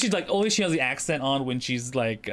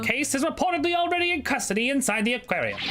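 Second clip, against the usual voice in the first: high-pitched, projected news report; newscaster; 10 semitones higher than usual. Acted. TV reporter on the murder suspect, high newscaster delivery. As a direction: report it like a live bulletin.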